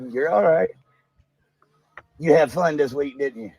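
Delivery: normal talking voice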